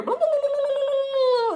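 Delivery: high, loud blah-blah gibberish; mock foreign chatter. Mimicking the workers talking in Vietnamese, high and loud. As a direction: imitate workers chattering away. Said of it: "they're talking in Vietnamese".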